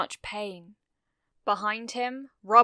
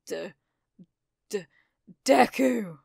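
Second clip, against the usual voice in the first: stammered, rough, bursting out louder on the name; breathed with effort; 5 dB louder than usual. A narrator's reading. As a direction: struggle to get the name out. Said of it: "he breathed".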